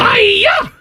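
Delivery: very high squealed Hi-ya!; karate-chop yell like Miss Piggy. Shown as a demonstration. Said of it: "That's all I can do with Miss Piggy".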